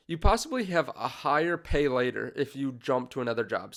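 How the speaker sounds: ordinary talk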